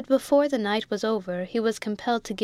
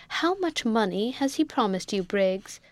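plain reading voice

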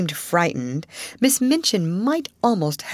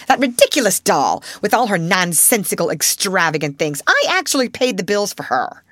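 scornful rant; 3 semitones higher, 4 dB louder than usual. Acted. Headmistress rants about the doll.

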